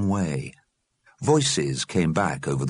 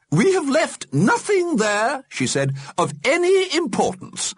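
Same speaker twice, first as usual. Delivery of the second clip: put-on grand old lady voice. Mrs Liberty answers Johnny.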